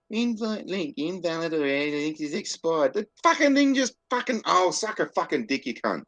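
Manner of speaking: high, loud swearing as the link fails again; real frustrated outburst. Real, not acted. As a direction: blow up at the broken app. Discord invite link keeps failing.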